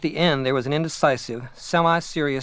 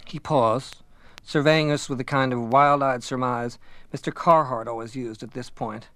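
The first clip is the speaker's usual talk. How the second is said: usual speaking voice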